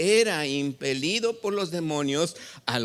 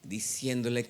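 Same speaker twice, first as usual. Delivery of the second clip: ordinary talk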